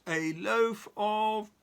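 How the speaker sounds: slow loud high shout; shouting at top of voice. Acted. Mister Noisy starts bellowing at Mrs Crumb. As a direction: bellow it, then stop short. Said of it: "shouting at the very top of his voice".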